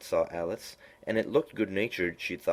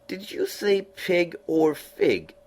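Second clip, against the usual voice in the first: high, slow, drawn-out cat voice; lofty knowing cat, high and slow; Cheshire Cat voice; 12 semitones higher, 5 dB louder, much slower than usual. A narrator's reading. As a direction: purr it out like a sly grinning cat.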